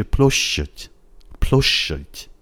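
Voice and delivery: "پلوشت" with a lengthened soft sh, hint of ch; soft shch. Shown as a demonstration. Teacher correcting the bare-sh version, soft shch. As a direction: tighten and hold the sh of "پلوشت".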